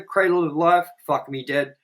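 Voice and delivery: ordinary delivery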